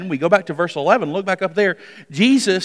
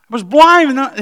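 excited exclaim; 6 semitones higher, 7 dB louder than usual. Acted. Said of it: "I was blind and now I see".

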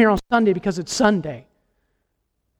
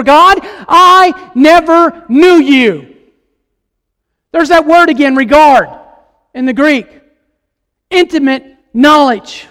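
raised-pitch, emphatic sermon delivery; preaching; 5 semitones higher, 7 dB louder than usual. Acted. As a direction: preach it with force, stretching key words. Preaches at full force.

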